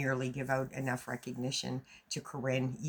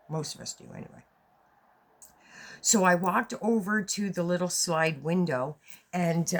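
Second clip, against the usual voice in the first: normal talking voice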